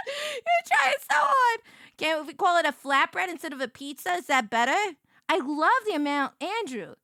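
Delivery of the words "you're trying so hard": teasing. Acted. Teasing a friend mockingly.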